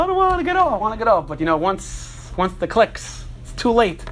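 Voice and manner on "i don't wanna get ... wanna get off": put-on scared child. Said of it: "Chaya starts crying".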